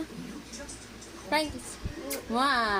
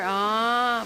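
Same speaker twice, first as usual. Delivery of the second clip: ordinary talk, nothing marked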